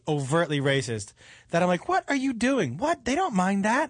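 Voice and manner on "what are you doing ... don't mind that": retold disbelief. Retelling disbelief at racist club owner.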